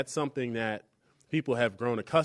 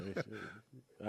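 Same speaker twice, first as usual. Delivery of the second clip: much softer, murmured repeat of we should; quiet aside; 15 dB quieter than usual. Real, not acted. Host repeating the bar idea to himself, quiet aside. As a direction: drop it to an aside under the breath. Joking about bar outing.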